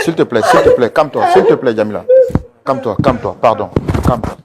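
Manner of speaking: loud, rushed pleas for forgiveness, half laughing; frantic pleading. Real, not acted. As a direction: beg her urgently over the noise. L'animateur tente de la calmer. (supplication affolée, forte, mi-rieuse)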